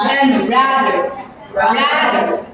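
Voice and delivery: rather said ræðər, the middle th buzzing; rather with voiced th. Shown as a demonstration. Teacher modeling rather for the class, voiced th. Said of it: "let's have the example given".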